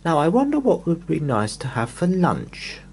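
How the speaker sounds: a hungry little man wondering aloud; Mister Greedy musing. Acted.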